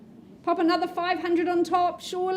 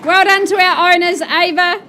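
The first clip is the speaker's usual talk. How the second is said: loud congratulations; 8 dB louder than usual. Real, not acted. Congratulating the new owners.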